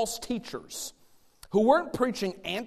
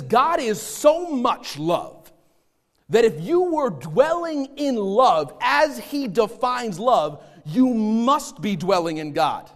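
slow, raised preaching voice, higher and forceful; emphatic preaching; 5 semitones higher, 5 dB louder, slower than usual. Acted. Preacher explains God's love to the congregation, emphatic preaching. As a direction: drive each phrase home like a preacher.

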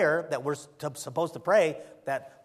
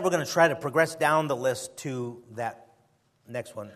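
ordinary delivery

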